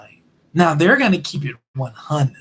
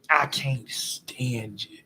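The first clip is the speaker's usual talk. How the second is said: whisper; 5 semitones lower, 9 dB quieter, much slower than usual. Retold or imitated. Whispered line in the rant.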